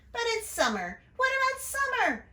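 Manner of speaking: high, loud little-brother call. A narrator's reading. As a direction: call after her from the doorway. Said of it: "her brother called".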